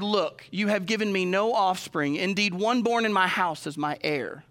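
slow, measured Bible reading; scripture reading. Acted. Pastor reading Genesis to the congregation, solemn and slow. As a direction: read it out like holy writ, deliberately.

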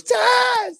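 high, loud mock cry; wrestler's pained yell. Hurt wrestler calling out to commentator, pained yell. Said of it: "somebody hit him in the nuts".

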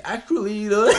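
high mock voice of an awkward speaker; mocking another speaker. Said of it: "this guy sounds like really, like".

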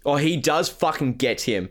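usual speaking voice

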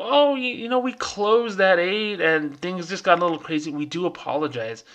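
high, put-on apologetic service voice; sing-song fake apology from a call centre; a lightly mocking customer rep excusing the delay. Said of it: "they go".

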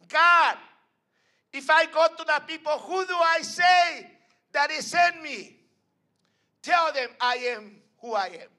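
high, loud, fast preaching; preaching at full force. Acted. Preacher voicing Moses to the congregation, loud and high. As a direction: lift the whole church with it.

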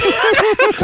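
giggling fit after a crude joke; high-pitched laugh. Real, not acted.

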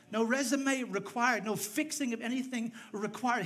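loud, high, impassioned sermon delivery; full-force preaching. Real, not acted. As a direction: drive each statement home to the whole congregation.

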